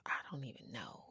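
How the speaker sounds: unvoiced aside, barely audible; whisper. Real, not acted. Trailing off in a whisper while recalling old watches. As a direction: drop to a whisper while trying to remember.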